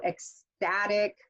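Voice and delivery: slow "ecstatic" with STAT stressed; penultimate stress. Shown as a demonstration. Teacher demonstrating the rule, second-to-last syllable stress on "ecstatic". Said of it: "second to the last syllable stress".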